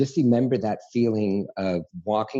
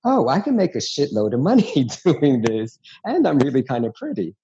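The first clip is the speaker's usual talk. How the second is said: louder, higher excited retold thought; pleased realization; 4 semitones higher, 5 dB louder than usual. Realizing drag can pay, retold with excitement. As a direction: relive a delighted realization, laughing lightly.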